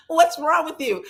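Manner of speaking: very high, loud exasperated snap; exasperated mother. Retold or imitated. Trina scolding clueless Toni at the wedding, high exasperated snap. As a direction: snap at her like a fed-up mother. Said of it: "Trina said".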